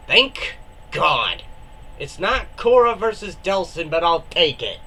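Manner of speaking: normal talking voice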